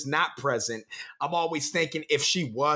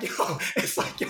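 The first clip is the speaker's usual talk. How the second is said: ordinary talk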